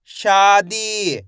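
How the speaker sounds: शादी very slow, both vowels held; stretched pronunciation. Shown as a demonstration. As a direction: draw out शा and दी in शादी. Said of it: "मैं इसका खींच के उच्चारण कर रहा हूँ".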